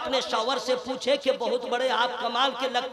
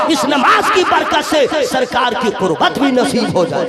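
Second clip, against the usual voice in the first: preaching at full force; 3 semitones higher, 14 dB louder than usual. Acted. Preacher urging the congregation, loud fiery sermon. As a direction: drive it home to the crowd.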